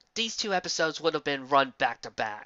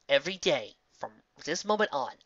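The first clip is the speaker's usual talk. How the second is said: ordinary delivery, nothing marked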